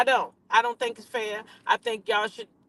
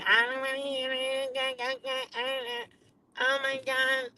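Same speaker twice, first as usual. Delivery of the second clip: mock stuffy voice; 5 semitones higher, 6 dB quieter, much slower than usual. Repeats phrase in put-on voice.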